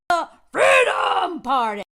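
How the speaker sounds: slow, loud mock-announcer shout; mock grand shout. Mocking the Austrian party, a hammed-up booming shout. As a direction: boom the name like a pompous announcement.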